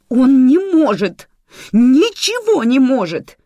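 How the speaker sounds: shouting. Acted. Nika yelling to Manana about useless Lozo, screaming. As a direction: scream it out in frustration. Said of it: "закричала Ника".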